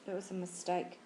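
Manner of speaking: low and soft, barely getting it out; muffled voice. A narrator's reading. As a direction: mumble it into the pillows. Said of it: "in a muffled voice".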